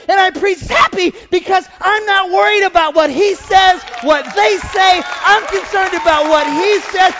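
loud, high-pitched, rousing preacher shout; full-force preaching. Real, not acted. Preacher urges congregation to enjoy life, shouted rousing preaching. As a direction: belt it out to a congregation, stretching key words.

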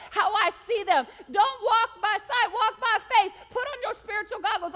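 loud, high, building preacher's shout; exasperated divine rebuke. Retold or imitated. God urging the speaker to walk by faith, forceful shouting.

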